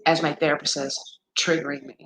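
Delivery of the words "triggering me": normal talking voice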